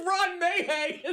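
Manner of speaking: laughing. Real, not acted. Repeating name while laughing.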